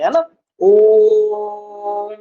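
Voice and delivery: ओ held very long on one steady note; long sustained ओ, teacher's demo; prolonged vowel. Shown as a demonstration. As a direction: stretch the ओ out slowly to show the pluta vowel. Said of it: "थोड़ा सा समय लगे बोलने में".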